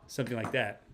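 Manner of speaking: ordinary talk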